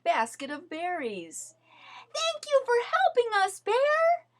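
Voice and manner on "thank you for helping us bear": high, fast, cheerful thanks; the friends thanking Bear, high and quick; bright, high group thanks; high friends' voices. A narrator's reading. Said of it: "they said".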